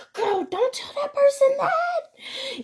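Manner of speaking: high, slow, drawn-out friend voice; mock friend warning. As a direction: draw it out high like a girlfriend's warning nudge.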